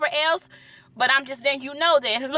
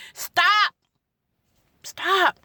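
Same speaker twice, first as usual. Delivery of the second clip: firm, raised repeated command; emphatic stop; 5 semitones higher than usual. Real, not acted. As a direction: plead with parents, firm and raised. Pleading with parents to stop.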